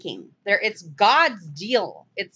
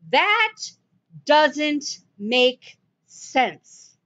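"That" high, then "doesn't make sense" very slowly spaced; word-by-word insistence on "doesn't make sense"; emphatic disbelief; 5 semitones higher, much slower than usual. Real, not acted. Preacher rejecting the flat-earth reading, "doesn't make sense" slow and emphatic.

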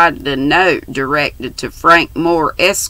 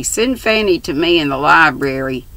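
ordinary delivery

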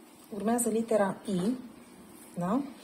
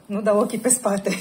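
words spilling out through a laugh; 2 semitones higher, 8 dB louder than usual. Real, not acted. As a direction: laugh as you say it. Laughing while talking to client. (râzând în timp ce vorbește)

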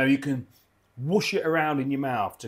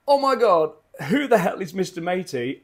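high, loud, baffled outburst as a puzzled viewer; mock disbelief at an odd name; imagined viewer's shock; 5 semitones higher, 6 dB louder than usual. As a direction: exclaim in loud surprise like a confused fan. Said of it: "You're gonna go".

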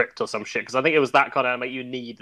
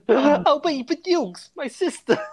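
high mock voice; 11 semitones higher, slower than usual. Quoting a remembered video.

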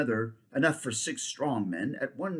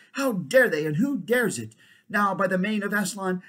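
much louder, higher furious oath; furious king; 8 semitones higher, 8 dB louder than usual. Acted. King swearing vengeance with sword drawn, furious. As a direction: roar with rage.

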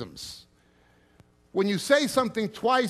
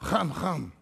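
repeated with a laugh; slower than usual. Shown as a demonstration. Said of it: "I would say".

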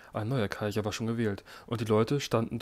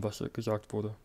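usual speaking voice

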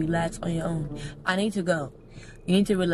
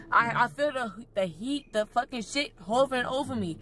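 ordinary talk

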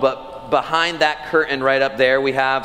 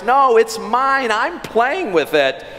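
put-on child retorting; 8 semitones higher than usual. Preacher imitates his daughter refusing her brother, mock-indignant. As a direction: voice a stubborn little daughter. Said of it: "Eva retorts".

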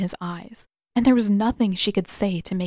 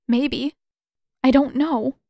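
1 tearful uncertainty; 5 semitones higher, 4 dB louder than usual. A narrator's reading. Katara admits crying.

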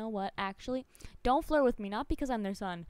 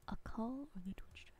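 whispered aside; 15 dB quieter, faster than usual. Real, not acted.

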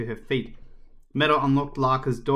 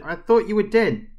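high, loud, falling exclamation; shocked outburst; 7 semitones higher, 7 dB louder than usual. Acted. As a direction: blurt it out after a fright. Girl's outburst after the rescue.

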